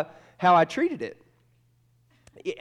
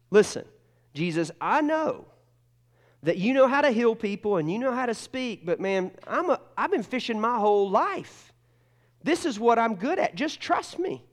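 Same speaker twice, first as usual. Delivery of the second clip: Peter protesting; 8 semitones higher than usual. Retold or imitated. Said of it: "Peter realizes".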